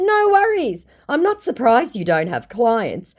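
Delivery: high, mocking no worries; sassy comeback. Vlogger re-reading her sassy reply to the scammer. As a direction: toss it off with a sneer.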